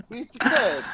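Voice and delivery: Bicicleta said high with an exaggerated Italian lilt; Italian accent. Shown as a demonstration. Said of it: "it's Italian".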